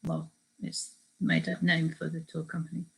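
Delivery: ordinary delivery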